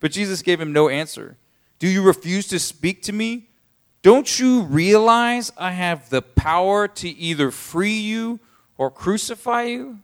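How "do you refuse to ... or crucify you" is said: high, slow, menacing governor; a hint of a threatening Pilate. Pilate threatens Jesus with his power, high and slow.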